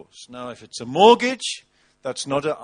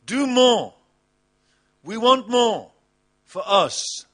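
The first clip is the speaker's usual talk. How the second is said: high, drawn-out demanding "Give me more"; mock greedy plea; 6 semitones higher, 4 dB louder, slower than usual. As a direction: whine for more like a spoilt worshipper. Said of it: "our theme is".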